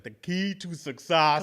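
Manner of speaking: put-on slow, heavily stressed Austrian-accented delivery; Arnold Schwarzenegger impression. Podcaster quoting a star's simple advice, Arnold Schwarzenegger impression. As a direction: stretch each word out like an action-star mentor. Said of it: "He don't talk like that".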